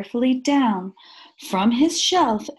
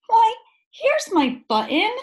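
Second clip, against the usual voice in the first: much higher, loud happy cry; delighted cry; 12 semitones higher, 4 dB louder than usual. A narrator's reading. Corduroy finding his button, excited high cry. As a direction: burst out with joy at the find. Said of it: "he cried".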